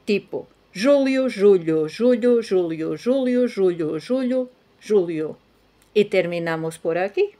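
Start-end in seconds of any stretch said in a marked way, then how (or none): 0.7-5.4 "Julio" alternating júlio and julho in a sing-song run; both words together. Shown as a demonstration. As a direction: chain ZHOO-lyoo and ZHOO-lhoo, pitch seesawing. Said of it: "vas a decir las dos palabras".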